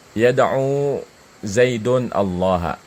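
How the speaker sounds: Yad'u Zaidun Allaha read slowly, each word separated; slow example sentence. Shown as a demonstration. Teacher reading an Arabic example to students, slow. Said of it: "kita bikin kalimat".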